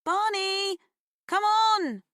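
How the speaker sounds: high, loud call across the field. A narrator's reading. Girl calling her dog across the dark field, loud and high. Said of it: "she called".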